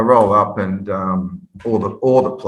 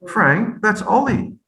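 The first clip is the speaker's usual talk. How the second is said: higher, sheepish captain voice; lightly voiced teammate; captain's answer; 11 semitones higher, slower than usual. Captain telling the coach who the player is, pitched-up.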